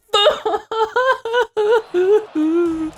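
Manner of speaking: high shrieking laugh close to tears; crying laugh. Real, not acted. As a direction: laugh helplessly in despair. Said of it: "I'm crying right now".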